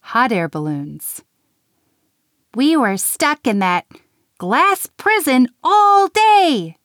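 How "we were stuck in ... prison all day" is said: loud, high angry outburst, slowing at the end; furious exclaim. A narrator's reading. Nim storming at Santa, furious and loud. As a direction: shout it out furiously. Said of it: "Nim exclaimed".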